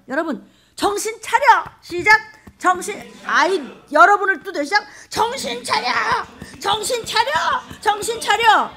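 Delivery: preaching shout. Real, not acted. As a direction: hammer the congregation awake.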